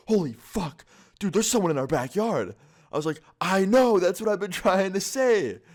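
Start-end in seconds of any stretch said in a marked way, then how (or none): 0.1-2.6 higher, loud panicked blurt; panicked friend. Retold or imitated. Friend panics after slamming door. 3.4-5.6 high, loud, laughing told-you-so; exasperated. Retold or imitated. Telling the panicked friend they were right all along.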